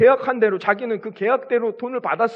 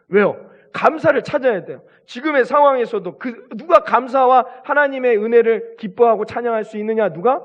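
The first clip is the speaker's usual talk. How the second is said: raised, impassioned preaching; preacher pressing his flock, louder and higher; fervent preaching; 4 semitones higher, 4 dB louder than usual. Acted. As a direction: drive the point home to the congregation. Rising sermon intensity.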